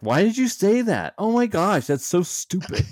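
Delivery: high, shrill-pitched mock bickering; mock angry spouse. Newlyweds arguing on the game show, high mock anger. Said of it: "They're getting all mad".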